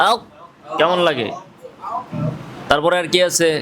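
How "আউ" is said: আউ closed off at the back of the tongue; demonstrating a stopped, held আউ. Shown as a demonstration. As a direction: hold আউ shut with the tongue against the palate. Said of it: "জিহ্বার গোড়ার উপরে তালুর সাথে লাগাইয়া".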